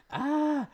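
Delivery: high-pitched mock alarmed "ah"; comic startled squeal; startled yelp. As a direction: yelp as if caught by mom. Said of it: "that's the mom mentality".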